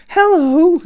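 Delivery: high, loud character hello; high-pitched theme park princess; Mulan. Retold or imitated. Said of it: "Mulan walks to the table".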